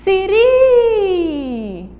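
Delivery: "Siri" with RI held very long, high and loud; exaggerated falling long RI in "Siri"; final syllable stressed. Shown as a demonstration. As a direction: call out "Siri", stretching the last syllable. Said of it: "A sílaba tônica é o".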